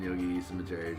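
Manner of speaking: normal talking voice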